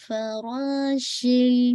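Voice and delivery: فراشل drawn out slowly; slow recitation demo. Shown as a demonstration. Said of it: "کل".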